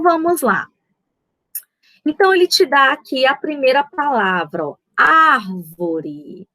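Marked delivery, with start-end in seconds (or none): 5.0-6.5 "árvore" very slow, with a long "ár"; lower, stretched "árvore", leaning on "ár"; stress on ÁR. Shown as a demonstration. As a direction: draw out the first syllable "ár" of "árvore". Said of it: "ela é a sílaba tônica".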